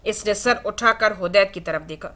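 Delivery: ordinary delivery, nothing marked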